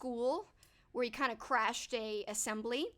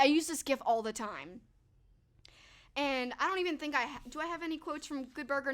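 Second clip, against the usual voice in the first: usual speaking voice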